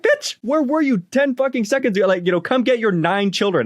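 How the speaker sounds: high-pitched, exasperated telling-off; indignant retort. Retold or imitated. Dog owner retells scolding the mom, high indignant outburst. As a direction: act out scolding a negligent parent.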